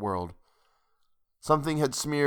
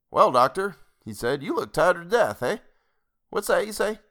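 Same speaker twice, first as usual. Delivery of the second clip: higher-pitched rustic innkeeper; folksy old landlord, genial; 7 semitones higher than usual. A narrator's reading. Landlord hailing the tired doctor, folksy and inquiring.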